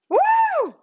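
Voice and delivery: high, loud whoop of excitement; excited woo. Real, not acted. Host cheering to open the morning show, loud high whoop. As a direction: let out a big happy woo.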